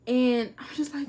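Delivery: ordinary delivery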